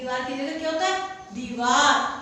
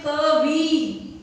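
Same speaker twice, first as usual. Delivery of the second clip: slow drawn-out demo; 7 dB louder, much slower than usual. Shown as a demonstration. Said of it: "दबा के बोलेंगे, छोटी मात्रा आएगी".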